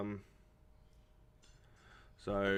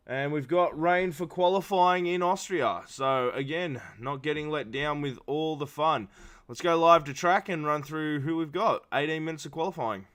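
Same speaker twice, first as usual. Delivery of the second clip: upbeat, higher live broadcast read; race commentator; 5 semitones higher, 4 dB louder than usual. Acted. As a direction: hype it up like going live to track. Switches into live race-broadcast hype.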